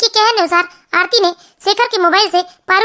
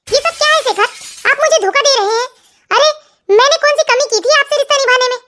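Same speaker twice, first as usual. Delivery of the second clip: higher-pitched, hurt accusation of betrayal; angry wife; 5 semitones higher than usual. A narrator's reading. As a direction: confront your husband in a raised pitch. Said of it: "आरती को बहुत गुस्सा आता है". (गुस्से में आहत पत्नी)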